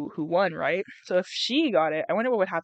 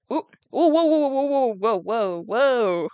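startled string of whoas, pitched up; shocked whoas; 3 semitones higher, 4 dB louder, slower than usual. Real, not acted. Reader recoiling at a bloody description. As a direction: recoil at the gory image.

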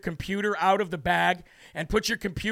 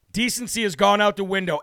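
normal talking voice

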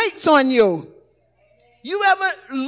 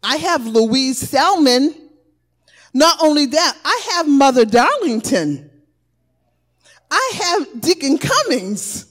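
normal talking voice

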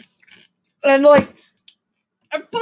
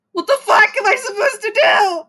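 high laughing cry of frustration; half-laughing exasperated yell; a laughing wail at the missing upload button; frustrated laughing outburst; 7 semitones higher than usual. Real, not acted.

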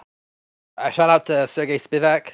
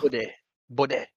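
softer attempt at British "Bode"; British accent; 3 semitones lower, 6 dB quieter than usual. Host echoing co-host's fighter imitation, light British accent. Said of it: "yeah, like".

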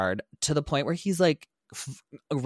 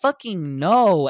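exasperated no; 6 semitones higher, 7 dB louder, much slower than usual. Retold or imitated. Refusing neighbors' question.

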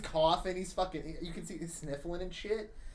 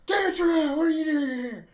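high, loud yelling coach; 12 semitones higher, 10 dB louder than usual. Retold or imitated. Coach catching a student sniffing markers, yelling. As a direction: bark it out like an angry gym coach. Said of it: "kinda yelling".

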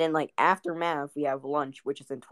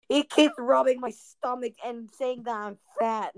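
high, aggrieved mock complaint; angry kid; 8 semitones higher, 4 dB louder than usual. Angry kid explaining what happened, high imitated grievance. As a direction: whine it out as a worked-up kid. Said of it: "angry kid".

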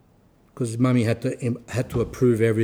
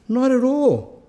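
put-on higher Greek-accented brush-off; 10 semitones higher than usual. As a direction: Greek accent, high and dismissive. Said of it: "I'll do the exact accent".

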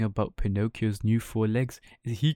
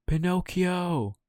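high, slow cry of his name; drawn-out, high alarmed call; 8 semitones higher, 3 dB louder, slower than usual. Acted. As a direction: call out in dread. Finding him face down.

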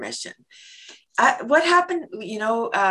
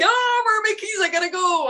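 high frantic voice hunting for keys; panicked high squeak, late and lost; frantic high-pitched fluster; 14 semitones higher than usual. Pretend. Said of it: "You're like".

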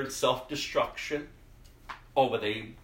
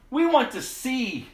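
pleading cry; 13 semitones higher, 5 dB louder than usual. Acted. Blind men answer Jesus.

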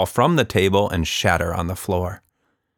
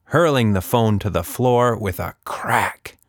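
ordinary delivery, nothing marked

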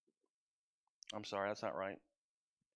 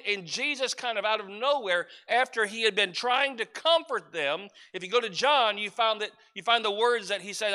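usual speaking voice

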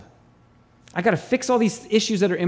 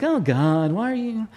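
mock whining. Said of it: "we get a little bit whiny".

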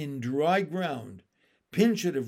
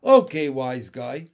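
annoyed turtle; 3 semitones lower, 8 dB louder than usual. Acted.

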